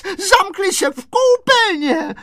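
shrieked high, loud and slow; screamed alarm. A narrator's reading. Woman alerting neighbours to burglars, screaming. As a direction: scream out where the burglars are hiding. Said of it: "paní Kadrnošková vyběhla na chodbu a křičela".

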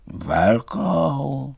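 drawn-out questioning thistle reply. A narrator's reading. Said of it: "řekl bodlák".

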